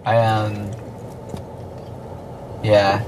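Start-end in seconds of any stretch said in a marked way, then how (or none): none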